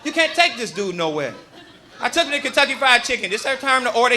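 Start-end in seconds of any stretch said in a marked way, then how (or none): none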